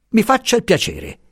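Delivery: snapped out high, boiling over; the professor finally boils over; impetuous outburst. A narrator's reading. Professor cutting Pasotti off, impetuous high outburst. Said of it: "diss'egli impetuosamente".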